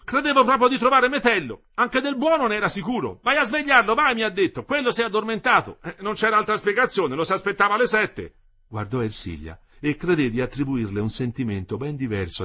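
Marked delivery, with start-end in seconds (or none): 0.0-8.3 put-on high, loud, worked-up young man; agitated young man. A narrator's reading. Young Renzoni explains himself to Ersilia, agitated. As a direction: pitch it right up, agitation barely mastered. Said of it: "Per quanto dominasse malamente la sua agitazione".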